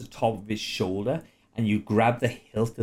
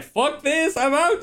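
high squeaky panicked voice; fleeing crossbow guy; slower than usual. Acted. Crossbow guy panicking as he flees. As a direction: squeal it as he bolts. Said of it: "the crossbow bolt guy is like".

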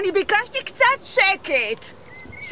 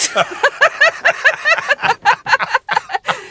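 hearty laugh; 8 semitones higher than usual. Acted. Group laughs at the joke.

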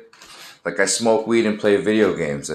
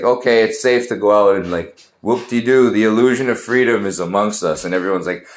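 ordinary talk, nothing marked

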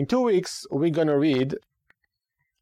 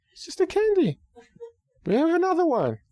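mock dismissive parent. Parent dismisses candy complaint.

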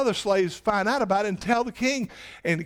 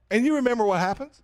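usual speaking voice

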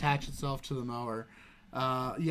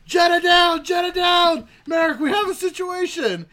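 very high, loud mock alarm; full-voiced imitation of a panicked holler; imitated scream; 13 semitones higher, 9 dB louder than usual. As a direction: scream an emergency report to a colleague. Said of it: "as he screams".

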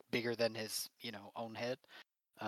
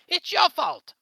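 much higher, very loud blaming shout; villain's angry yell; 15 dB louder, slower than usual. Acted. Villain kicking his shadowy servant, shouting blame. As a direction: lash out at the minion.

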